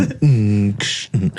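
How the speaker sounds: low hum, then a hissy "ksh"; low mechanical hum-and-click imitation; imitated machine noise. Retelling the Pepsi joke, imitated noise.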